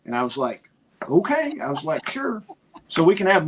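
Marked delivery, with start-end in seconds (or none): 1.1-1.5 squeaky high okay; high-pitched reluctant okay. Retold or imitated. Reluctant agreement with Lindsay. 2.1-2.4 high, quiet sure; soft, high-pitched reluctant sure. Retold or imitated. Reluctant agreement.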